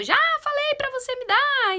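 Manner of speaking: high, loud mock child yell; child's shouted demand. Child demanding something from a parent, shouted. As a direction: whine it out loud like a demanding kid. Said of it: "A criança gritou".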